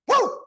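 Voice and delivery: very high, short "woo"; pumped-up whoop. Real, not acted. Speaker pumped up by own lesson, excited whoop. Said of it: "I'm so pumped up".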